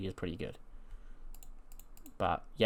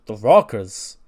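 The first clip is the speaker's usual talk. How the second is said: louder, puzzled repeat of the name; confused shout of the name; loud confused question; 10 dB louder, slower than usual. Retold or imitated. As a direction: blurt it back in disbelief. Confused wrestler replies.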